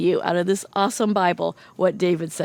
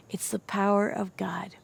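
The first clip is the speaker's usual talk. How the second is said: hushed, reverent whisper; 6 dB quieter than usual. Acted. David telling Saul in a hushed whisper. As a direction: breathe it out like a secret.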